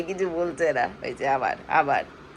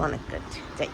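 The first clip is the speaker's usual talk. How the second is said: whisper; 4 semitones lower, 9 dB quieter than usual. Real, not acted. Whispered goodbye while leaving.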